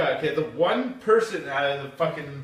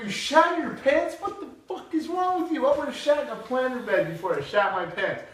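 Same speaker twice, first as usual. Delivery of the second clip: much higher, incredulous scolding; hammed-up shock at the friend; outraged disbelief; 9 semitones higher than usual.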